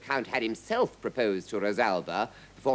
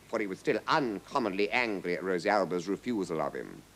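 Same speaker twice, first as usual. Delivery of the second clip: usual speaking voice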